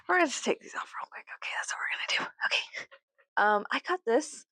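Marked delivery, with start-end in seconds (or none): 0.1-2.9 hushed, quick whisper to herself. Real, not acted. Removing glasses, she whispers to herself. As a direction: whisper it fast under your breath.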